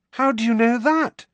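very high, loud disbelief; astonished. A narrator's reading. Nephew questioning his aunt, astonished. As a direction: blurt it out in amazement.

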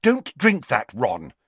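high, loud, sharp warning. Acted. Hermione stops Ron as he lifts the glass, sharply. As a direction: cut in sharply to stop a friend. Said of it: "spoke sharply".

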